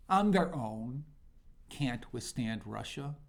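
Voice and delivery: ordinary talk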